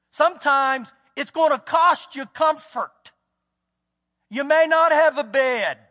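pounding sermon delivery; preaching at full force. Acted. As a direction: drive the cost home to the congregation.

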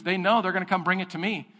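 ordinary talk

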